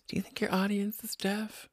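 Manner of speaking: very soft, whispery quote; mocking whisper. Podcaster repeating mother's text, mock whisper. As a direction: imitate the complaint in a tiny hushed voice.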